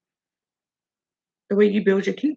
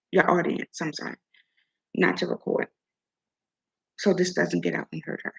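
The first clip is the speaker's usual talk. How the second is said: ordinary delivery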